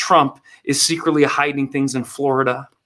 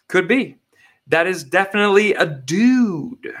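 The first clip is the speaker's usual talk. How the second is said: ordinary delivery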